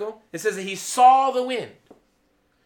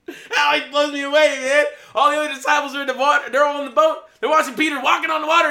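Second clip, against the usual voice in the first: laughing amazement; 5 semitones higher, 8 dB louder than usual. Real, not acted. Preacher marvels loudly, laughing.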